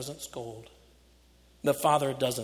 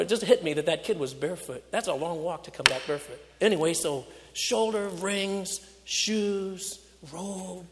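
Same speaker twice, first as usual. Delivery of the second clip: ordinary talk, nothing marked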